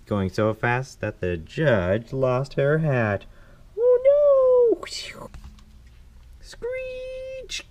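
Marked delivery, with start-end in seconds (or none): 3.8-4.8 high, loud "oh no"; dismayed cry. Acted. Narrator reacting to the lost hat, high dismayed cry. Judge loses her hat. 6.4-7.7 very high, drawn-out squeal; screeching brakes. Acted. Narrator imitating Thomas braking, high long screech.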